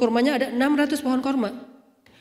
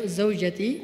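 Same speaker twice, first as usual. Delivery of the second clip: normal talking voice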